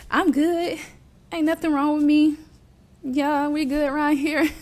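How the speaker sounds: high, put-on cheerful cover-up; pretending to be fine. Hiding depression from auntie, pretending all is good. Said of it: "still trying to be like".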